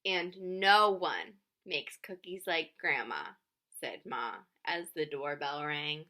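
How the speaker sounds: lively picture-book reading with voiced characters; reading to children. A narrator's reading. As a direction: read it out warmly, playing each child.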